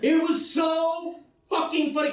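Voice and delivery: ordinary delivery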